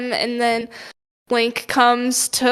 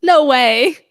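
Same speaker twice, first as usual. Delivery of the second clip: sarcastic; 5 dB louder than usual. Real, not acted. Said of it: "I'm being sarcastic".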